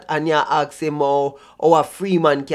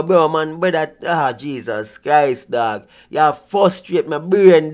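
normal talking voice

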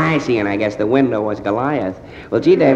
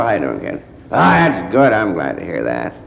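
normal talking voice

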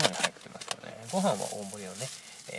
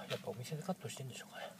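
whispering; 10 dB quieter, faster than usual. Real, not acted. Repeats the thought in a whisper.

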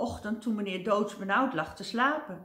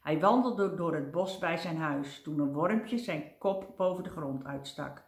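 ordinary delivery

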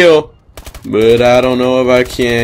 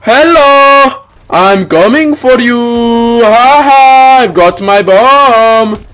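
put-on loud, high Indian accent; 10 semitones higher, 5 dB louder, slower than usual. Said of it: "Totally not stereotyping".

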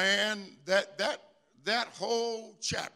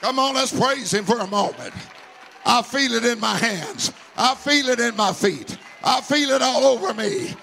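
loud rising preacher's climax; full-force preaching; 3 semitones higher, 8 dB louder than usual. Acted. Pastor urging the church to shout and praise, loud and rising. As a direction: build the congregation up, louder.

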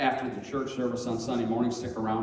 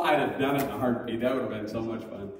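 ordinary talk, nothing marked

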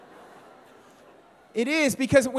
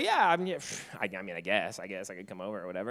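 much lower and softer, fast, feigning indifference; fake casual; 14 semitones lower, 5 dB quieter than usual. Boy to new friend Zach, faking casual indifference, low and fast. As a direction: shrug it off like it's nothing. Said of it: "I was like".

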